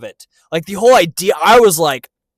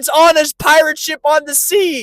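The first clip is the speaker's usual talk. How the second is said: raised, exasperated fan plea; impassioned protest; 10 semitones higher than usual. Real, not acted. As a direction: insist on it like a worked-up romantic. Heated plea for romance, continued.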